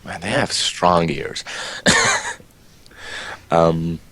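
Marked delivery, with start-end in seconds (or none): 1.5-2.3 high-pitched burst of laughter. Real, not acted. Player amused by their strong ears, high laugh.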